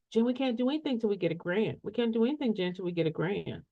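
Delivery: soft, quick imitated complaint; mock helpless client. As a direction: mimic a client's excuse under your breath. Mimics clients' excuse about grants.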